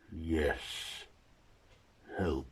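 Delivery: lightly voiced monster, word by word; creature's halting speech. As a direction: leave a long gap between words as the creature. Creature answers in single words.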